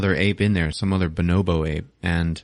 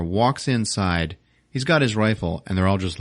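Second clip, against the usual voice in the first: plain reading voice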